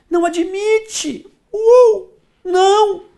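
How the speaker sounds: theatrical mock sob, high and loud; mock wail. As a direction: wail in fake despair over the wrong answer. Mock dramatic reaction to wrong answer.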